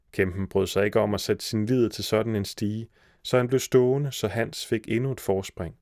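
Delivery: plain reading voice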